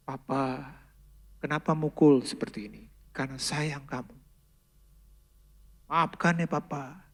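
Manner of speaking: soft, hushed father's comfort; tender apology. Retold or imitated. Father hugging his crying son after the spanking, softly apologetic. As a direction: gently say sorry while holding the child.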